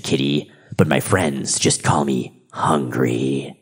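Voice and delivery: low, slow, sinister death figure; slow, looming menace of a reaper; Grim Reaper voice. Acted. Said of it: "dialogue here from this Grim Reaper entity".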